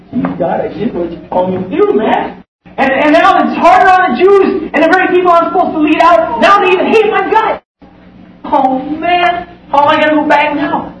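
high, exasperated complaint to God; complaining Moses. Retold or imitated. Moses complaining to God after Pharaoh refuses, high exasperated voice. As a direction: whine to God that it got worse.

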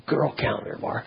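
unvoiced whisper on the touchy words; whispered aside. Drunk colleague whispering about the girl calendar. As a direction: drop to a whisper as if it's shameful.

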